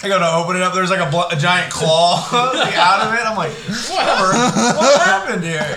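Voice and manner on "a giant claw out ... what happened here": high and loud, laughing through it; cracking up at the imagined sight; laughing mock alarm. Friend imagines opening the ice cream, laughing in mock shock.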